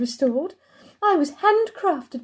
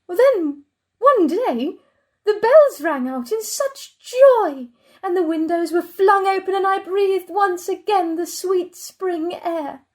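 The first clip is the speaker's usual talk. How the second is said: higher, lifted, savoured delight; joyful relief; 4 semitones higher than usual. Acted. Chair recalling windows flung open, joyful relief. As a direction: let the joy ring out, pitched up.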